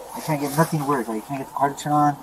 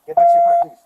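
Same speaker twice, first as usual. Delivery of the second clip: high, much louder order; desperate demand; 12 dB louder than usual. Real, not acted. Armed man sending officer back to car, desperate shout. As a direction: drive them away with a raised, urgent voice.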